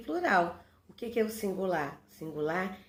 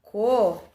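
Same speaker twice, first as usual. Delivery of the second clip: "Co" loud and standing alone; isolated syllable; 9 dB louder than usual. Shown as a demonstration. Teacher dividing a word for pupils, isolated syllable "Co". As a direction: punch out "Co" as one separate syllable. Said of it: "dividir a palavra, olha lá".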